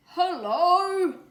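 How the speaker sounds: very high, loud chorus greeting; calling out. A narrator's reading. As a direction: call out together from the tree. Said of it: "everyone called out together".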